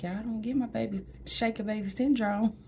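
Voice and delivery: drugged, high-pitched goofy warning; medicated silly. Retold or imitated. New mother on medicine warning nurses, high goofy voice. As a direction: babble like the meds kicked in. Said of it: "That medicine is something else".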